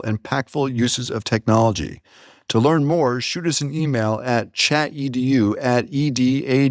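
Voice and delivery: ad read. Acted. Host reads the Screen Shift ad to listeners, upbeat promo voice. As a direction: sell it like a promo spot.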